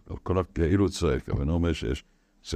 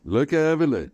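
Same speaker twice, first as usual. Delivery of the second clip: high, loud call; raised, urgent outcry; crying out; 9 semitones higher, 4 dB louder than usual. Shown as a demonstration. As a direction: cry it out high and strong. Calls out the roared cry.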